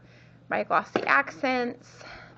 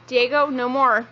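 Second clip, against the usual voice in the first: calling out; 3 semitones higher, 8 dB louder than usual. Real, not acted. Mother calling out to her kid.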